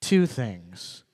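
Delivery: ordinary talk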